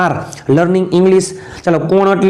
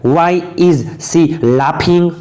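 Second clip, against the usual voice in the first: slow dictation; much slower than usual. Shown as a demonstration. Teacher models English sentence slowly.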